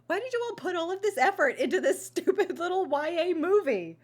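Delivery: high-pitched, loud exasperated rant; mock screaming. Viewer berating star actors over a silly movie, screaming. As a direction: yell it out in comic outrage. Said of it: "I'm screaming into the mic now".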